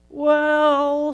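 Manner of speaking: a big high yawn on the word. Acted. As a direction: stretch it out mid-yawn. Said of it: "Sammy yawned".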